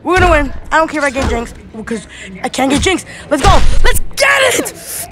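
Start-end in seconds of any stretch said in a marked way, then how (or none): 3.3-5.1 loud, very high hype shout; excited yell, climbing high; triumphant cheer. Real, not acted. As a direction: whoop it as victory lands. Cheering a win.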